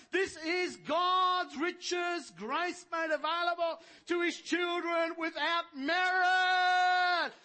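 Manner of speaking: high, loud, slow proclaiming sermon; full-force preaching. Acted. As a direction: build the crowd up to the kingdom.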